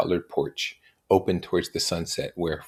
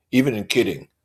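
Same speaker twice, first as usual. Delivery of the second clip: cross; 4 semitones higher, 5 dB louder than usual. A narrator's reading. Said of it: "objected Tom crossly".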